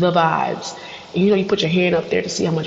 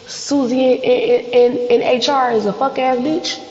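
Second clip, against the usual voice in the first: venting; 7 semitones higher than usual. Retold or imitated. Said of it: "I come home and I say".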